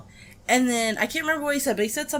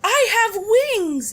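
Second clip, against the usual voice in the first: put-on high, loud child squeal; excited little girl; 13 semitones higher, 6 dB louder than usual. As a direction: squeal it like a thrilled little kid. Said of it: "and she said".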